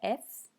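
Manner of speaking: एफ said as in English; teacher demo of एफ; French letter F. Shown as a demonstration. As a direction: model एफ for F. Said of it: "एफ बोला जाता है".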